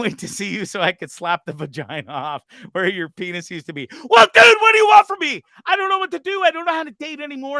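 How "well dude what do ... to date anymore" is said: much higher, loud, cracking yell that settles; exasperated shout. Real, not acted. Answering the host's jab about his love life, shouting in exasperation. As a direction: throw your hands up and shout it.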